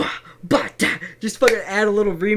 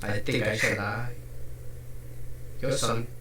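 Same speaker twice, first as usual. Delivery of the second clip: a lightly voiced dying son, soft and lower; solemn letter voice; 13 semitones lower, 4 dB quieter than usual. Son writing a last letter to his mother, quiet and low. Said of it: "way too well done".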